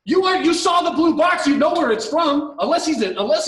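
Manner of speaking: high, loud disbelieving yell; outraged shout. Real, not acted. Ranting at the woman about the blue box, outraged shout. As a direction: blast her in disbelief.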